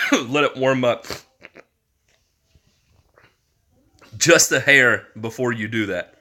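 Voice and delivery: coughing through the words; choking up. Real, not acted. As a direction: keeps choking while talking. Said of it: "I keep choking".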